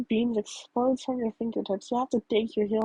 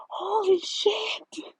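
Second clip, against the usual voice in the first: slow, breathy whispered Holy shit; muffled shout; 8 semitones higher, much slower than usual. Acted. Air startled from behind the hero, muffled. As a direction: gasp it out hushed and slow. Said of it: "a muffled shout".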